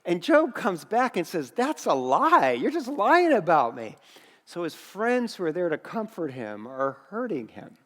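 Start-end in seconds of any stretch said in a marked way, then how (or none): 1.5-4.0 acted protest with swooping pitch; indignant denial. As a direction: protest like a man falsely accused. Job hits back at accusing friends.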